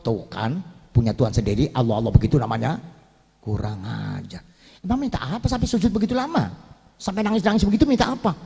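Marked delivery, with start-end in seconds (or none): 3.4-4.5 lower, softer, slow Pharaoh growl; low angry mutter. Acted. (Firaun menggeram pelan dan rendah)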